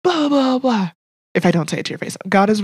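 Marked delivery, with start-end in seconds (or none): 0.0-0.9 high-pitched, falling blah-blah; mimicked private grumbling; mock venting. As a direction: rant it out in nonsense syllables, pitched up. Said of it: "I'll get in my car and just, like, be like".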